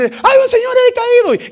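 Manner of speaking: very high, loud alarmed yell; alarmed shout. Retold or imitated. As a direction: cry out that a man has collapsed. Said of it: "y dice".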